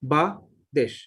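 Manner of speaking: বা and দেশ said apart, with a pause between; two separate syllables. Shown as a demonstration. Teacher showing the shortcut word, বা and দেশ as two separate syllables. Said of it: "syllable উচ্চারণ করলাম".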